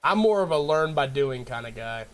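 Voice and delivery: normal talking voice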